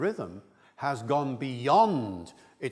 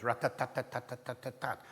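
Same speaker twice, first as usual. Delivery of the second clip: even, staccato "tat" beats; syllable-timed rhythm; 5 dB quieter than usual. Shown as a demonstration. Lecturer showing Indian English rhythm, evenly beaten. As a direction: rattle out "rat-tat-tat" with equal stress. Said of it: "That kind of rhythm".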